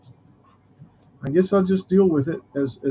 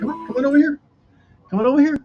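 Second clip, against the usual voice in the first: coaxing pet voice; 11 semitones higher, much faster than usual. Real, not acted.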